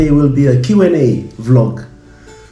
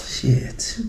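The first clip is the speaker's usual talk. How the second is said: whispered under the breath; whispered curse; 3 semitones higher, 10 dB quieter than usual. Real, not acted. Muttered curse after wife's jab.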